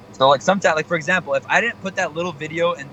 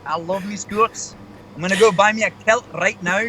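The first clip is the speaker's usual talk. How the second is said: put-on Scottish accent; 5 semitones higher than usual. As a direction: ham up a Scottish brogue. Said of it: "I'm great at my Scottish accent".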